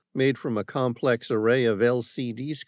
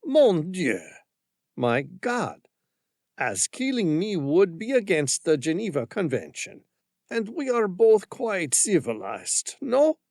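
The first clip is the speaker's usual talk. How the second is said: put-on higher, reserved French-accented reasoning; 5 semitones higher than usual. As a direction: talk her down with French composure. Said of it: "reserve bordering on mastery".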